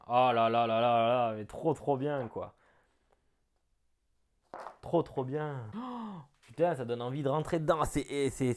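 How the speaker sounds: loud delighted gush over the map; thrilled. Real, not acted. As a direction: burst out with real excitement.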